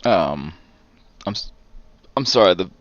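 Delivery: hiccuping mid-sentence; words broken by hiccups. Real, not acted. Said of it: "these beers are giving me mad hiccups".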